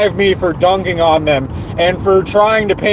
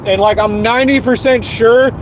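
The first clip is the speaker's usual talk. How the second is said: normal talking voice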